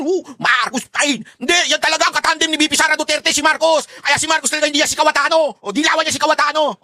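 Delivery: mock critic voice. Mocking critics' past claims.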